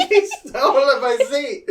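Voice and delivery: high, giggly mock complaint; laughing protest. Real, not acted. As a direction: complain through real laughter. Laughing at cat taking seat.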